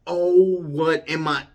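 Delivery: loud, high exclamation; amazed outburst. Retold or imitated. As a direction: burst out in retold amazement. Retold outburst at seeing hands.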